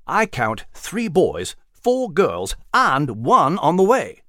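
slow, altered gentleman's voice; gentleman Nigel. Acted. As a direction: dryly count them off like a gentleman. Nigel counts the children.

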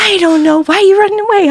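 put-on high-pitched back-and-forth of child and mom; re-enacted frantic exchange. Mom questioning her runaway son in pajamas, re-enacted alarm.

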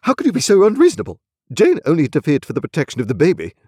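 fast, high, loud protest; exasperated husband's rapid remonstrance; remonstrating. A narrator's reading. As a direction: plead urgently with an unreasonable wife. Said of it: "demonstrated Mister Pocket".

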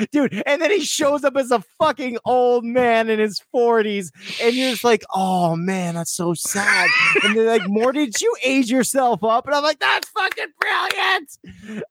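high, gushing fan delight; high-pitched awed "That's fucking brilliant"; excited. Real, not acted. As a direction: swoon over it, high and slow at the end.

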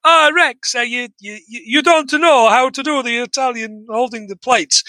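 put-on Italian accent, jokey and high; mock Italian accent. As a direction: play a cartoon Italian waiter. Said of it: "He's going".

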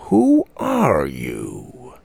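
low, very slow and drawn-out; demanding old man. A narrator's reading. Grandfather confronting the stranger, low and slow. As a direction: lean on it like an interrogator.

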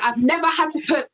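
normal talking voice

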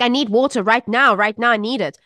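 put-on urgent kid voice; insistent child's demand. Voices her daughter's insistent demand.

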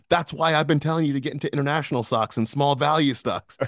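a half-hearted meme-style shout; mild mock yell. Son in the meme yelling back at his dad, mock yell. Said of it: "the son was screaming".

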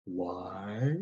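Rom's deliberate, sunken Why; slow Rom why. Shown as a demonstration. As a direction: drag the Why down low. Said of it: "there's a slowness about him".

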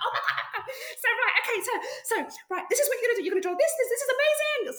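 high, loud, laughing excitement; babbling thrilled instructions; overjoyed. Retold or imitated. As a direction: gush over the discovery. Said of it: "And I was like".

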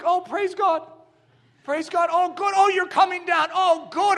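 high, loud mock cheering; mock excitement. Preacher mock-cheers the sloth coming down, excited. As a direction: cheer him on in fake delight.

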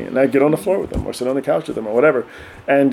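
ordinary talk, nothing marked

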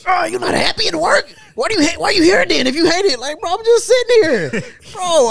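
high, loud put-on protest; mock break-room argument. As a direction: act out a heated coworker exchange.

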